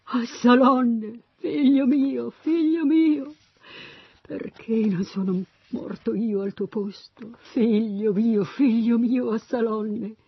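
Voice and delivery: high, wailing lament; crying out in grief. A narrator's reading. King mourns the dead son, crying out in grief. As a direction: draw out the cries through tears. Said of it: "Se ne andò gridando".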